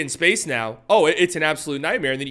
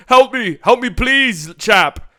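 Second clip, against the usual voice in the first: put-on loud, high-pitched Australian-accent cry for help; bad Australian accent; 7 semitones higher, 7 dB louder, much slower than usual. Beaten defender begging for help, in a bad Australian accent. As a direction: call out in a hammed-up Australian accent. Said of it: "that was my Australian accent".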